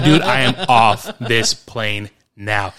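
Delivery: slow, loud, word-by-word insistence; comic ultimatum, each word of "off this plane now" separated; emphatic refusal. Retold or imitated. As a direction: lay down "off this plane now" slowly and firmly. Retold refusal to stay aboard.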